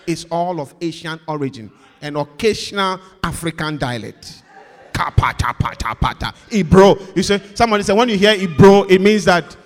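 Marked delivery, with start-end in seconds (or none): 4.9-9.6 loud, hammed-up fake tongues; mock speaking in tongues. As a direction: ham up a showy tongues display. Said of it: "the way I speak in tongues".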